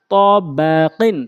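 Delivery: drawn-out syllable by syllable reading; slow demo. Shown as a demonstration. Teacher showing learners the word first, slowly. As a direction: stretch each syllable for learners. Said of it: "প্রথমে আমি slow বলছি".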